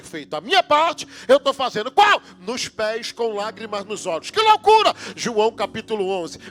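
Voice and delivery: loud, high, rising sermon climax; preaching at full force. Acted. Preacher shouting over the church about Mary's tears.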